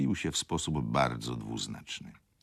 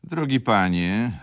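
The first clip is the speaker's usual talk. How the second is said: louder, higher, slower baron calling out; 8 semitones higher, 8 dB louder, slower than usual. Acted. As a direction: raise the voice to drown out the wife. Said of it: "zawołał nie mniej głośno".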